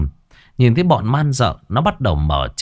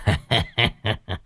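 evil laugh; 6 dB quieter than usual. A narrator's reading. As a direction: chuckle with cruel satisfaction. Sorcerer gloats over dying boy.

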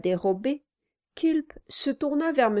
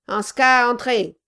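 gruff gravelly dwarf voice; 4 semitones higher, 8 dB louder than usual. Acted. Dwarf Kilp voiced in dialogue.

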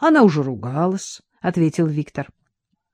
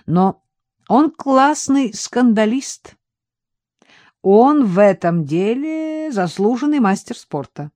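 smirking; 6 semitones higher, 4 dB louder, slower than usual. A narrator's reading. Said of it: "добавил с усмешкой Виктор".

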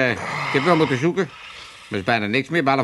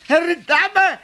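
shocked exclamation; 10 semitones higher, 4 dB louder than usual. Acted. Learns it was sprouts with peanut butter.

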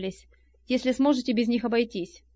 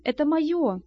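higher, lightly begging protest; humbly pleading; 5 semitones higher, slower than usual. A narrator's reading. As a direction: plead a little, pitch raised. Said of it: "униженно взмолился он". (с лёгкой униженной мольбой, повыше)

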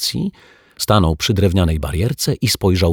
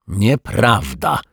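slow, drawn-out protest; 3 semitones higher, much slower than usual. A narrator's reading. Detective contradicting himself, protesting. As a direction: contradict it, stretching the word out. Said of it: "zaprotestował detektyw Pozytywka".

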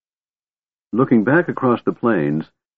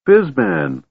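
high delighted kender shout; joyful cry; 9 semitones higher than usual. A narrator's reading. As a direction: greet an old friend with glee. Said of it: "cried Tasselhoff".

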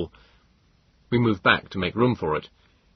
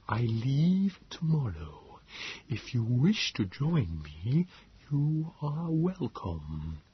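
slow, much softer alien hush; Morlock whisper; 5 semitones higher, 7 dB quieter, much slower than usual. A narrator's reading. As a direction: confide a secret plan quietly.

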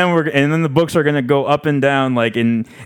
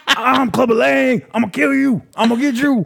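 Clubber Lang voice; 11 semitones higher than usual. Retold or imitated. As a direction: threaten like a brawler. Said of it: "He's like".

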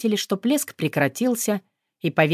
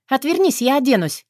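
put-on girl's request; 8 semitones higher than usual. A narrator's reading. Caught naked girl asks him.

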